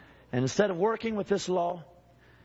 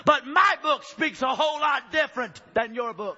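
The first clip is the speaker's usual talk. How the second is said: shouting preacher; 8 semitones higher than usual. Acted. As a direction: hit the peak of the sermon.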